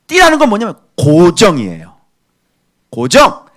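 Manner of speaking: ordinary talk, nothing marked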